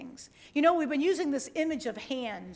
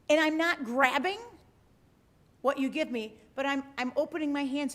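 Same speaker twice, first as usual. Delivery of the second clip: ordinary delivery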